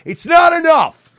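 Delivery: high, slow, furious shout. Acted. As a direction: blow up at the audience. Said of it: "being all upbeat and positive".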